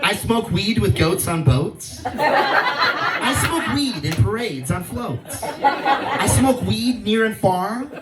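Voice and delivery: slow, rhythmic rhyme reading; poem recital. Pretend. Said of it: "what do you think about my poem".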